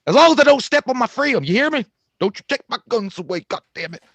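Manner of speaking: loud, high mock-indignant Southern accent. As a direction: protest loudly in a hammed-up Southern accent. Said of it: "I was doing my Southern accent there".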